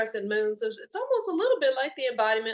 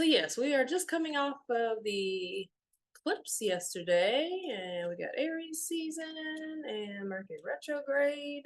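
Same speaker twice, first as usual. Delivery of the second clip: ordinary talk, nothing marked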